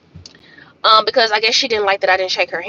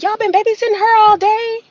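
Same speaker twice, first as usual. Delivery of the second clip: quoting herself; 10 semitones higher than usual. Retold or imitated. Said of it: "and I was like".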